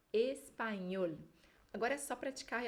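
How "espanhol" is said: espanhol, very slow, in Spanish style; Spanish pronunciation. Shown as a demonstration. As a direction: spell out espanhol slowly as a Spanish speaker would. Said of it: "Em espanhol falamos". (espanhol bem devagar, à espanhola)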